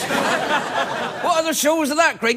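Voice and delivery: high, slow West Country questioner; west coast of England accent. Pretend. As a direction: ask in a put-on West of England voice. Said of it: "strange west coast of England accent".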